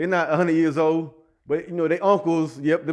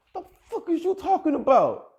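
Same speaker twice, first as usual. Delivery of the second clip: a much higher mock yell of a woman; imitating angry woman; 11 semitones higher than usual. Mimicking the woman who was yelling at him, much higher. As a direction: squeal it like she's losing it.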